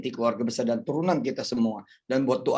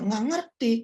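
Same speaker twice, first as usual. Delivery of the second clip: rising, higher and louder exasperated voice; Moses answering; 4 dB louder than usual. Retold or imitated. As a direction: make Moses sound pushed to the edge. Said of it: "kata Nabi Musa".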